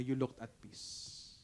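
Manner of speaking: soft slow echo. Retold or imitated. Pastor echoing the compliment, hushed and slow. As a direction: let it fade to a hush. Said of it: "sabi niya".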